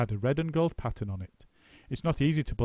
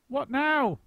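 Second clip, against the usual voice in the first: high, louder chorus; a crowd waiting for orders; high eager chorus; eager monkeys; 5 dB louder than usual. A narrator's reading. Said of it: "they all said".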